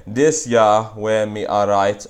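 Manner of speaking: slow Westmoreland Jamaican patois reading. Shown as a demonstration. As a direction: read it slowly in Westmoreland patois. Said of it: "I Westmoreland dialect".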